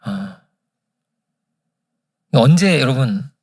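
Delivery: ordinary talk, nothing marked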